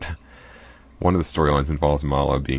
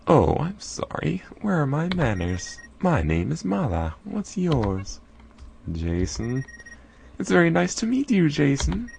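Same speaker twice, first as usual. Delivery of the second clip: put-on high, sweetly polite cave girl; Mala's voice; 12 semitones higher, slower than usual. Mala introducing herself to Jason, sweet and high. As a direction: charm the newcomer. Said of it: "I don't know why I'm talking like that".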